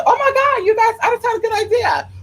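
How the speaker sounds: high, thrilled gasp of an idea; squealing over a new idea; sudden excitement. Real, not acted. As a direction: burst out with a brilliant idea.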